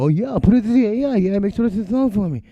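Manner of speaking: high, loud, put-on careless voice; mocking lazy producer. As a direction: parody the user who lets the AI do it all. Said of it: "and then you're like".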